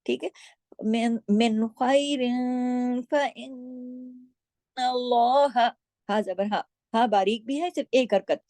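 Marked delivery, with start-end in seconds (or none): 0.8-5.7 very slow melodic chant of मिन खैरिन फ़इन्नल्लाह; tajweed chant; Quran recitation. Shown as a demonstration. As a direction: recite the whole phrase, drawn out and sung. Full verse recitation.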